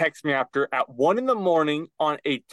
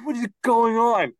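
baffled; 9 semitones higher, slower than usual. Retold or imitated. Retelling confusion at locked door.

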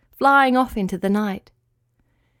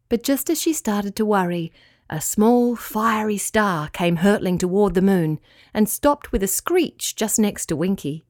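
ordinary delivery, nothing marked

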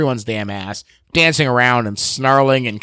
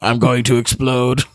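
mock talking heart. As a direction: play a heart announcing itself from inside a chest. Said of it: "coming out of his chest and be like".

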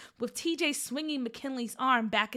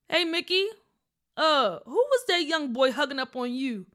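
dad voice; 4 dB louder than usual. Acted. Dad questioning.

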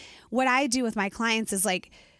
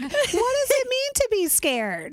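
curious; 14 semitones higher, 3 dB louder, slower than usual. Retold or imitated. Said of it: "or, like".

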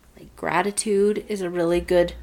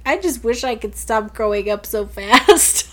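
higher, mock tearful child voice; upset daughter; 4 semitones higher, 3 dB louder than usual. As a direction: imitate a kid lamenting, lightly. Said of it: "and she's crying".

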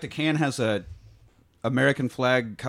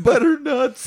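squeaky high mock blubbering, louder; Harlan crying; 7 dB louder, slower than usual. Sensitive Harlan crying over the police horse, high blubbering. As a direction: wail the name through fake tears. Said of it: "He's crying so hard".

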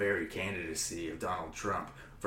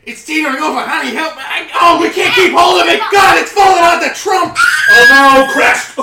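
high, loud laughing panic shout; panicked shouting; 28 dB louder than usual. Acted. Briefcase tipping out of the trunk, shouting panic. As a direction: yell in rising panic as it tips.

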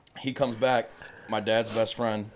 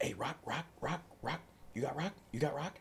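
quiet crackhead ask; 4 semitones higher, 12 dB quieter than usual. Retold or imitated. Crackhead asking for rock.

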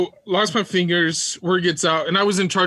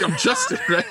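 laughing quote. Retold or imitated. Telling the sergeant his name, cracking up with laughter. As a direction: voice jumps high, breaking into laughter. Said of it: "I was like".

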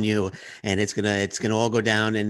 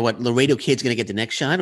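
ordinary talk

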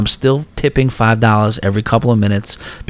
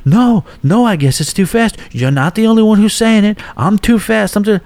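much higher, sharp sarcastic outburst; mock the dealer cracking under pressure; imitating a dealer snapping, pitched way up; retold snap; 10 semitones higher than usual. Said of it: "she just snapped".

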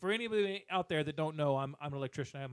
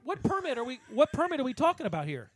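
high, loud incredulous shouting; venting at the county dump; exasperated rant; 8 semitones higher, 5 dB louder than usual. Real, not acted. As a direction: yell it in disbelief. Ranting about the permit.

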